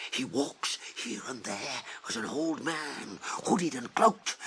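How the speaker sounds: urgent whisper. Acted. Recalling warning about Saruman.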